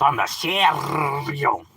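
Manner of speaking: "serio" dragged out very slowly with a long rolled r; exaggerated, slow trilled "serio"; mock homie drawl. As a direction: stretch "serio" like a hammed-up homie. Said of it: "hear a homie talk like that".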